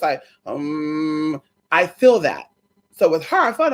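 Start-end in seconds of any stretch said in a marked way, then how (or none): 0.5-1.4 bassy humming. Shown as a demonstration. Showing the vibration they feel, bassy hum. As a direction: hum with bass in the voice. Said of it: "The tremble and the bass in the voice".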